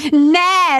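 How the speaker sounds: high loud shriek; frantic shriek. A narrator's reading. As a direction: shriek as if hurt.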